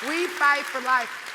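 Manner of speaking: loud, slow, each word landed; slow rallying cry. Real, not acted. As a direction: chant it like a rally slogan.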